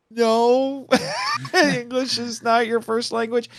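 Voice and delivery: high, laughing "No" then a teasing verdict; half-laughing fake protest at the screen; mock exasperation. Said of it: "I'm like".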